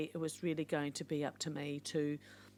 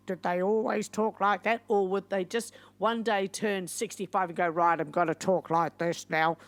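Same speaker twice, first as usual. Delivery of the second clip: mock old person voice; 3 semitones higher, 7 dB louder than usual. Vlogger mimicking elderly voices, hint of old age. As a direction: poke fun at how old folks suddenly talk.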